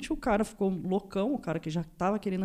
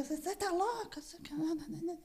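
high, softer mock protest; retold fight retort; 10 semitones higher, 7 dB quieter than usual. Woman retelling a scuffle to hosts, mock high-pitched protest. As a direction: squeal it quietly, high and babbling.

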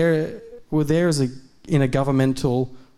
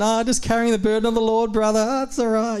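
higher, mock-holy martyr voice; mock pious sigh; 8 semitones higher than usual. Preacher mocking churchy suffering talk, high mock-pious. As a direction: lightly parody a pious brother.

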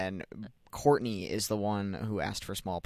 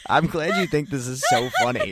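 laughing while scolding; 6 semitones higher, 10 dB louder than usual. Real, not acted. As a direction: say it through laughter.

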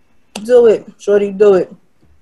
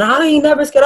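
mock scream; 8 semitones higher than usual. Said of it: "She started screaming".

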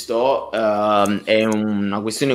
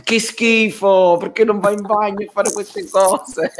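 much higher, disgusted scolding woman; disgusted shopkeeper; 11 semitones higher than usual. Retold or imitated. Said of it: "la tizia ha fatto".

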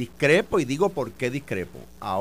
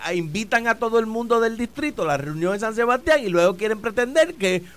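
louder and higher, rising indignation; heated accusation; 5 semitones higher, 6 dB louder than usual. Real, not acted. Politician accusing rivals of a packed meeting, heated and loud. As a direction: press the accusation home.

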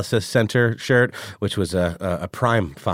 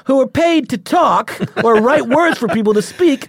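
high, loud, excited pitch-man voice; Hollywood producer voice; 5 dB louder than usual. Pretend. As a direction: sell it like a slick New York producer. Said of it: "the producer guy that I'm channeling here".

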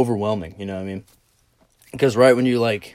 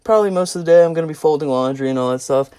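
flustered, high-pitched self; 7 semitones higher, 4 dB louder than usual. Retold or imitated. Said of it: "And I was like".

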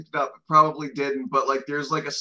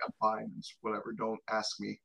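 low, very soft, noncommittal; quiet, lowered deflection; dismissive brush-off; 5 semitones lower, 10 dB quieter than usual. Acted. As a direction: brush the question off under your breath. Acting out brush-off when asked.

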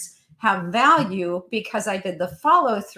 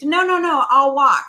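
put-on child's panicked shout, much higher and louder; retold child's scream; 5 semitones higher, 7 dB louder than usual. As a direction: yell it like a child giving in fast. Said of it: "he screamed".

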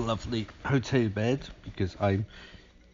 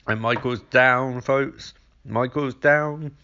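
mock boxing announcer; 3 semitones higher, 3 dB louder, slower than usual.

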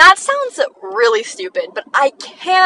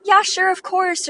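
high, drawn-out eager agreement; enthusiastic yes; 10 semitones higher than usual. Acted. Friend saying yes to hanging out, enthusiastic. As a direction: agree gladly, voice lifted well up. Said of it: "with an explanation point".

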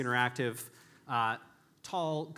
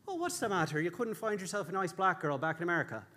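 high Irish barman, laughing through; well-meaning Irish barman, pitched up; bad Irish accent; 4 semitones higher than usual. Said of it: "are you ready for a bad Irish accent too".

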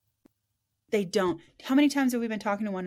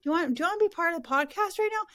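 calling out; 8 semitones higher than usual. Real, not acted. Calling out to Terry off-mic.

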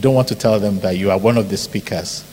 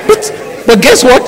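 high, louder, through a laugh; 13 semitones higher, 7 dB louder than usual. Real, not acted. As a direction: ask it still laughing. Laughing after joke.

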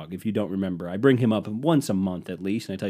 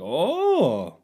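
fake pleasant oh; 6 semitones higher than usual. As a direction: pretend you like it, brightly. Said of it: "But you outwardly go like".

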